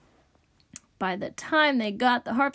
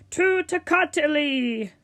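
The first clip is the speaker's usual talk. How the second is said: slow, drawn-out, raised complaint; exasperated rejection; 4 semitones higher, slower than usual. A narrator's reading. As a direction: stretch out the objection in disgust. Rich dude rejects toccata.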